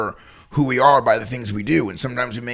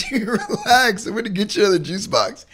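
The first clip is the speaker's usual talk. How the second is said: mock dad, laughing, a bit higher; 10 semitones higher than usual. A dad to his crying toddler, amused and calm. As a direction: soothe the kid through a chuckle. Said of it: "Dad's like".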